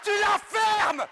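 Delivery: very high, full-force angry yell; exasperated screaming. Acted. Fed-up helper snapping at his shouting colleague, exasperated screaming. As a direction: explode and out-shout the other man. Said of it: "je peux crier!".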